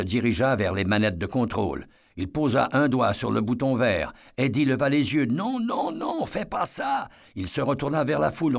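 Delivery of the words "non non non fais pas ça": much higher, slowing on the last words; horrified no, no, no; panicked plea. Acted. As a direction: beg him to stop in alarm. Eddie sees the button pressed.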